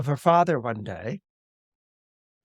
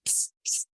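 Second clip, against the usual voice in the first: hushed psst; 10 dB quieter than usual. Acted. Hidden brother signals his sister.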